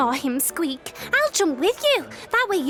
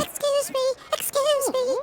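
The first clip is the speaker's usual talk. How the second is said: squeaky mouse voice; 6 semitones higher than usual. Acted. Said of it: "Olive heard a squeaky little voice".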